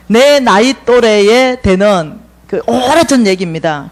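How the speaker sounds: usual speaking voice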